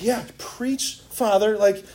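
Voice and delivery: a hint of bride cheering on the preacher; exasperated bride. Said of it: "it's usually, like, her who's like".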